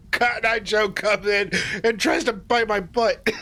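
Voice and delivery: laughing. Real, not acted. Laughing while retelling the attack.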